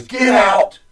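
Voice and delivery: sudden high shout of "Get out!"; retold shout. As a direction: bark it at him like a warning. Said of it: "she turns her head and says".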